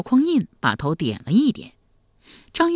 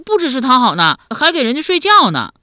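put-on high, loud boyish blurt; artless young nephew; 7 semitones higher, 8 dB louder than usual. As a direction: exclaim it like a tactless lad. Boy blurts out shocking detail.

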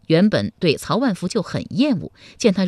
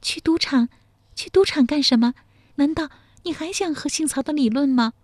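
rising, questioning, a bit higher; mild disbelief; girl puzzled why he wants the casino; 6 semitones higher than usual. A narrator's reading. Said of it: "福秀英一脸疑惑说".

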